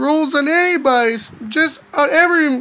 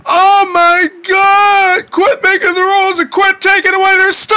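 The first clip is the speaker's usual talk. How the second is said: loud, higher frustrated yell; exasperated outburst; 4 semitones higher, 3 dB louder than usual. Acted. Kid ranting about parents taking away stuff, exasperated yell.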